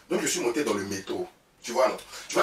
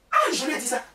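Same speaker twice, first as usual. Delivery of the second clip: triumphant crowing; 12 semitones higher, 4 dB louder than usual. Real, not acted. Triumphant exclamation, high loud.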